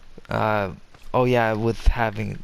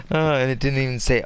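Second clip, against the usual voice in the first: usual speaking voice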